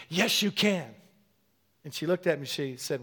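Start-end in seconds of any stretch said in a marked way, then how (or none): 0.1-0.9 rousing, higher-pitched push; strong exhortation. Retold or imitated. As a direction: say it to stir someone up. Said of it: "it was really strong".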